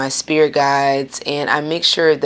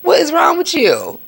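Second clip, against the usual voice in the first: mock indignation; 12 semitones higher than usual. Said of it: "I'm like".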